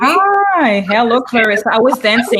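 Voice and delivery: bright, high and loud hello; excited greeting. Real, not acted. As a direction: burst in full of energy. Upbeat guest arriving dancing.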